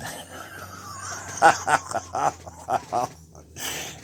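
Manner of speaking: high, breaking cackle of laughter; real laughing fit. Real, not acted. Joker cracking up before the punchline, high helpless laughter. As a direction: burst out laughing mid-joke. Laughs while setting up joke.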